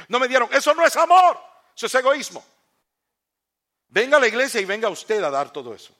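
very high, loud pulpit shout; fervent shouted rebuke; preaching outburst. Acted. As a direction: explode at the congregation. Preaching climax against selfishness.

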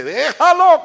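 high, loud, hammed-up dismissal; exaggerated gloating cry; mock vindictive glee. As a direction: toss it out loudly as a mock-pious avenger.

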